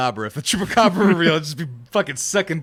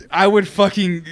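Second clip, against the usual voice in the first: ordinary delivery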